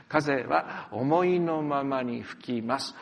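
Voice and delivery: slow, measured reading of 「風は思いのままに吹きます」; 「風は思いのままに吹きます」 spoken slowly for the congregation to read along; slow scripture reading. Shown as a demonstration. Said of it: "一緒に読みましょう".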